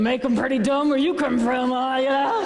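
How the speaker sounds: hammed-up scornful inner voice; waitress's mocking thought. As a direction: marvel at how dumb this customer is. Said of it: "She's thinking".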